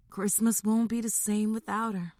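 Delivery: subdued, a touch mournful; softly voiced sadness over an absence; somber tone. A narrator's reading. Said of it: "said in a somber tone".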